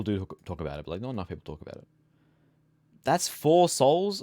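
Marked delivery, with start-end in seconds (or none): 3.0-4.2 high, loud, slow disbelief; surprised. Real, not acted. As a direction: drag it out in disbelief. Surprised at the cost.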